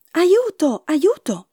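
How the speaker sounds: high-pitched storybook cry for help. A narrator's reading. Stolen harp calling to the giant for help, crying out. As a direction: call out in alarm, voice jumping up high. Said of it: "Ma l'arpa gridò".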